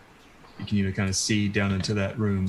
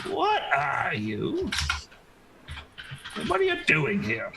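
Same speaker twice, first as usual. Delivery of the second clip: put-on slow, unsure old woman's voice, starting high; puzzled old lady peering at a stranger; cantankerous old woman; 5 semitones higher, slower than usual. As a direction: draw out the question like a wary crone. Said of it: "kinda cantankerous old woman".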